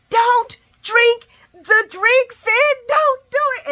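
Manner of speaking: high, very loud drawn-out scream at the screen; screaming plea. Retold or imitated. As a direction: scream it like begging someone back from the edge. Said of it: "I am screaming".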